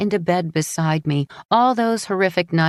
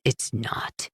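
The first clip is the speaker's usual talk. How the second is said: bitter; 4 semitones lower, 6 dB quieter than usual. Acted. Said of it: "he says bitterly".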